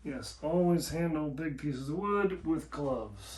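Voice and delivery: bad hammy Russian accent; deliberately awful Russian accent. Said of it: "In the worst Russian accent possible".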